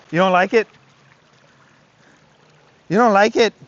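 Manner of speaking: coaxing dog. Real, not acted. Trainer coaxing his dog at the creek, high and loud. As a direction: sweet-talk the dog into the water.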